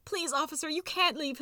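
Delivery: high-pitched pleading mouse; squeaky desperate appeal. A narrator's reading. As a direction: beg in a small, high voice. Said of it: "pleading for them to get his milk".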